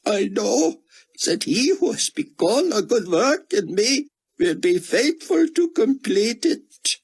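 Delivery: a broken scholar clinging to scripture; grieving Israeli scholar. A narrator's reading. Grieving scholar tells Buck his faith, high and squeaked.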